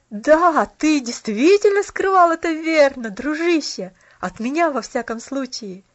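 loud, merry teasing of a friend; hearty, cheerful outburst; cheerful exclamation. A narrator's reading. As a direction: cry it out gladly, then ease off. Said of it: "весело воскликнул Джемми".

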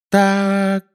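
high, loud motherly exclamation; sharp start from a worried mother; Mrs Weasley. Acted.

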